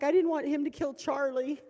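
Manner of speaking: high, loud distressed cry; distressed daughter. Retold or imitated. Daughter panicking about her dog, high and loud. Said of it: "She's like".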